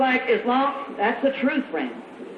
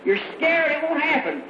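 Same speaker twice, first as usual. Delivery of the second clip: ordinary talk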